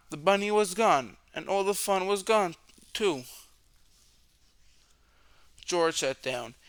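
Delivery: ordinary delivery, nothing marked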